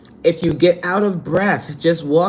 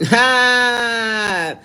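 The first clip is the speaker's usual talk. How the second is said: ordinary delivery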